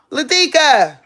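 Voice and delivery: high holler for the dog; calling out. Real, not acted. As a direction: call the pet's name across the house.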